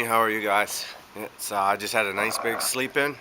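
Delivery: ordinary delivery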